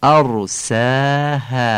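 ارساها very slow, with a heavy ر and light alifs; thin alifs. Shown as a demonstration. Teacher recites ارساها slowly. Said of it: "ترقیق الف ها در کلمهٔ".

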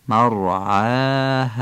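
مرعاها very slow, ending on a soft breathy ه; soft final ه. Shown as a demonstration. Teacher recites مرعاها very slowly. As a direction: let the ه in مرعاها be light. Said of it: "هی دو چشمم با ح جیمی اشتباه نشه".

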